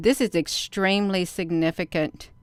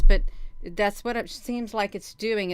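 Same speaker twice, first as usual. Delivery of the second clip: usual speaking voice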